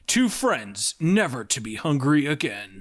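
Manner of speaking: ordinary talk, nothing marked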